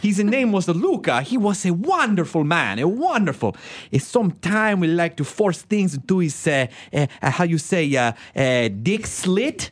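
put-on high, slow, lilting Italian accent; mock Italian director accent; imitated Italian accent. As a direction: fumble for words in a hammed-up Italian accent. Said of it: "accent and, and just say something horrible".

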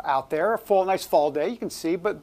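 normal talking voice

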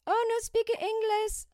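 high mock accent, clearly put on; comic imagines fooling the war planners; fake broken English. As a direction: play dumb in a hammed-up accent. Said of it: "that racist thing".

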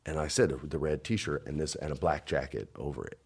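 ordinary delivery

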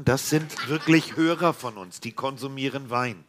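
ordinary talk, nothing marked

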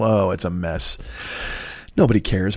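ordinary delivery

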